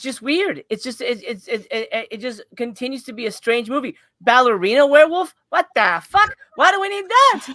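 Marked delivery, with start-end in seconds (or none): 4.2-7.5 loud, high-pitched disbelief; exasperated, raised-voice protest; incredulous outburst. Real, not acted. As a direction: demand an explanation, loud and baffled. Incredulous outburst about the plot.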